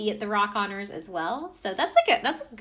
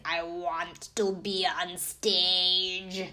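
slow, drawn-out imitation of a weird voice; 2 semitones lower, much slower than usual. Podcaster imitating the star's stage declaration, very weird slow voice. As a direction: stretch every word out in an odd put-on voice. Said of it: "in this very weird voice".

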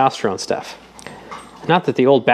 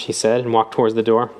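plain reading voice

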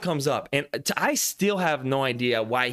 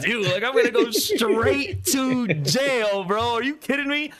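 mock panicked rant, slow and high; 8 semitones higher, 3 dB louder, slower than usual. Retelling friends his jail panic, high mock wail. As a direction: replay his own freak-out, drawn out. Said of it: "I was like".

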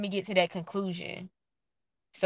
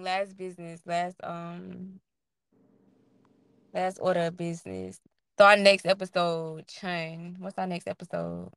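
normal talking voice

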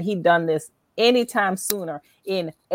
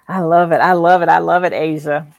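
bursting with real delight, louder; excited; 6 dB louder than usual. Real, not acted. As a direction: gush it, genuinely thrilled. Host reacts with delight.

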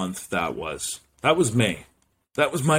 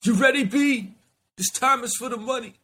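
hype persona voice; 13 semitones higher than usual. Acted. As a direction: announce yourself like a strutting alter ego.

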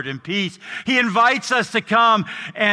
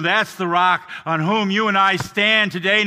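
ordinary talk

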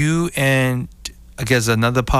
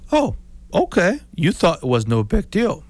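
mock surprise; 6 semitones higher than usual. Mock reaction in story.

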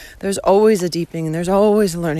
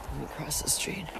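whisper; 4 semitones lower, 15 dB quieter, faster than usual. Real, not acted. Aside while walking in traffic.

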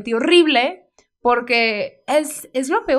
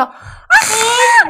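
long high scared scream, louder; retold scream; 8 dB louder than usual. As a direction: scream as if a mouse ran over your feet. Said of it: "Y yo".

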